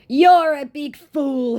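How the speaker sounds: high, slow put-on insult; scammer's insult mocked high and drawn out. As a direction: stretch it out high and mocking.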